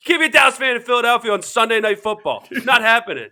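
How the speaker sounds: high, loud, rapid-fire outburst; mock scolding. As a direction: imitate an outraged mom laying down the law. Mimics the mom scolding fans.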